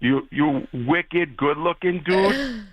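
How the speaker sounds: slow, lazy Boston drawl with dropped Rs; Boston accent. Shown as a demonstration. Bostonian showing the Jets fan a Boston accent, slowly. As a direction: stretch out wicked in a Boston accent. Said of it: "a tip on how to speak with a Boston accent".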